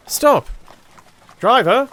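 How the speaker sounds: high, loud call to the driver; shouting stop. A narrator's reading. Holmes calling out to the cab driver to stop, loud shout. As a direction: yell to halt the cab.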